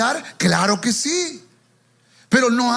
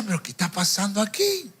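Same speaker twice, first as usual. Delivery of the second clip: pregunta perpleja, más suave, como para sí; desconcierto en voz baja; 6 dB quieter than usual. Retold or imitated. Retells his bewilderment amid the screaming. (perplejidad discreta)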